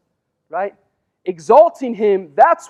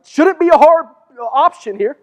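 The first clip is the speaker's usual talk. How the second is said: incredulous; 6 semitones higher, 7 dB louder than usual. Real, not acted. Joking aside to congregation.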